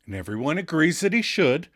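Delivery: ordinary delivery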